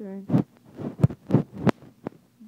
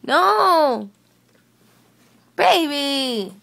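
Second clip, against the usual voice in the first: loud protest; 3 semitones higher, 7 dB louder, much slower than usual. Real, not acted. Dog crushing her.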